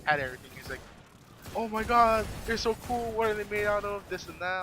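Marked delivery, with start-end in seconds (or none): 1.5-4.6 excited. Retold or imitated. Retold excited reaction to earrings.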